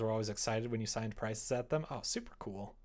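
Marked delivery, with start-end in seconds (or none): none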